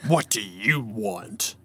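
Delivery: slow, low, imposing lion voice; giant lion. A narrator's reading. Giant lion questioning Elias at palace door, imposing. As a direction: draw it out slowly as a huge lion. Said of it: "asked the lion".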